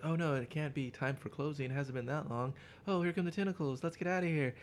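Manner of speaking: hammed-up frightened girl. Student fleeing the tentacles in hammed-up fright. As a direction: squeal it like a horror heroine. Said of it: "she's like".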